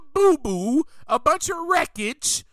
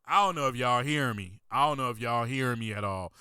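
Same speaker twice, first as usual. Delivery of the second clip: much lower, dropped-down aside; 13 semitones lower, 5 dB quieter, faster than usual. Real, not acted. Preacher checks whether y'all hear, low aside. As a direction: drop the pitch right down, half to yourself.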